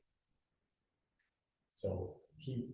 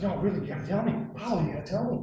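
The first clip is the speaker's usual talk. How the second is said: pleading; 10 semitones higher, 10 dB louder, faster than usual. Retold or imitated. Tibbetts begging Pablo for info.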